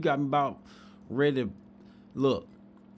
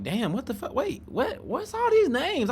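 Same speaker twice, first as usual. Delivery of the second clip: rising higher and higher in mock bewilderment; retelling his reaction to her slurs, climbing mock shock; retold disbelief; 12 semitones higher than usual. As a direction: build from puzzled to squeaky confusion. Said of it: "I'm like".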